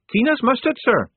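high eager recruit voice; nervous young recruit. Acted.

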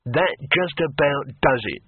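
slow, menacing growl; growling barbarian. A narrator's reading. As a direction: growl it, patience used up. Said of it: "growled Harun".

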